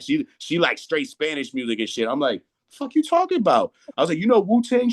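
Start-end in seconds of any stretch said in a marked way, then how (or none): 2.7-3.7 incredulous retort. Retold or imitated. Telling his girlfriend she can't be serious, high incredulous mock retort. 4.2-4.9 high, loud disbelieving question; incredulous question. Retold or imitated. Quizzing his girlfriend about Wu-Tang, high amazed questioning.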